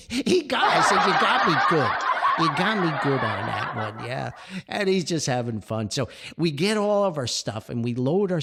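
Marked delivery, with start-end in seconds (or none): 0.1-4.3 laughing loudly through the words; chuckling as he admits he got him. Real, not acted. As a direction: concede the joke while cracking up. Laughing concession to teaser.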